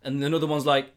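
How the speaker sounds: usual speaking voice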